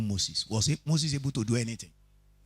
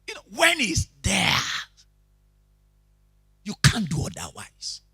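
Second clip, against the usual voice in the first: higher, louder shout; preaching shout; 7 semitones higher, 6 dB louder than usual. Real, not acted. Preacher exhorting the church with a shout. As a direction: fling it at the crowd.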